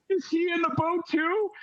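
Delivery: put-on high, anxious child questioning; little girl voice. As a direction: ask haltingly, very high and worried. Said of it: "kind of a little girl voice".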